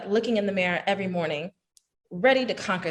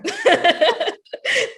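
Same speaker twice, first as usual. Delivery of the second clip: delighted laugh; 13 semitones higher, 6 dB louder than usual. Real, not acted. Delighted laugh at friend story.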